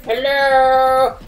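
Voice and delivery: booming giant greeting. Acted. Tim opening up the tiny world, booming giant hello. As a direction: boom it down from above.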